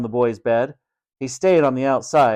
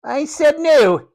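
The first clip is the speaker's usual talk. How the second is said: high, loud, drawn-out snap; snapped refusal; 5 dB louder, slower than usual. A narrator's reading. Matron refusing Tom's plea, high angry snap. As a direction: shut him down imperiously. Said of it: "snapped Matron".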